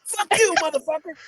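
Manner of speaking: high, hammed-up taunt; mock rage. Co-host acts Slapdog down in the kid's face, mock rage.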